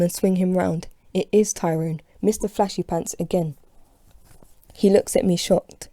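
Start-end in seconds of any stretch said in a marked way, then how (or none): none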